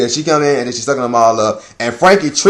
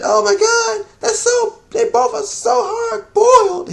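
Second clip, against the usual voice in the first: high squealing fangirl voice; swooning girls; slower than usual. Retold or imitated. Girls swooning over both fighters, high squeal. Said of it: "all these chicks come out".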